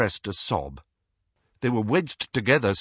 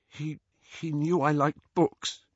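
higher, slow, halting and tearful; thick voice; 6 semitones higher than usual. A narrator's reading. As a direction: answer haltingly through a lump in the throat. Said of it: "said Hermione in a thick voice".

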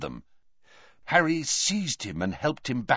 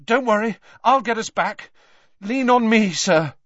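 higher, louder, pressing; frightened boy taking charge; anxious urgency; 10 semitones higher, 6 dB louder than usual. A narrator's reading. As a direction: urge him on, scared. Harry anxiously helping Dumbledore.

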